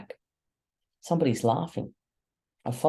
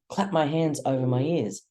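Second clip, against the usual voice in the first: usual speaking voice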